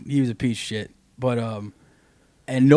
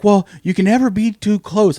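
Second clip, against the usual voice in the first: worried aunt; 9 semitones higher, 7 dB louder than usual. Retold or imitated. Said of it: "And she's like".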